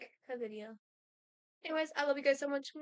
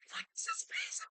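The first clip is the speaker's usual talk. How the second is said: high, breathy unvoiced whisper; creeped-out whisper; 4 dB quieter than usual. Real, not acted. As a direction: whisper it in disbelief. Whispered reaction.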